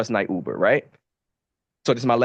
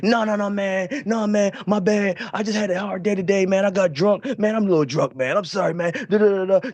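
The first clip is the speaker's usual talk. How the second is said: higher-pitched, pleading drunk passenger impression; imitating sobering-up drunk; 6 semitones higher than usual. Drunk passenger apologizing to the driver who stopped, imitated higher-pitched pleading. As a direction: gush apologies as a suddenly sobering rider, trailing into da-da filler. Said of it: "he start sobering up a little bit".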